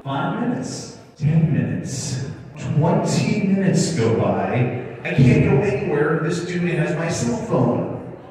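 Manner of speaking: high, loud, stretched-out retold fretting; comic exasperation, dragged out and escalating; mounting exasperation. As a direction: build from counting minutes to loud dismay. Reliving the wait for his phone.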